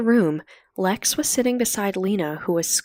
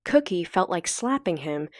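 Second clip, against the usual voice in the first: ordinary delivery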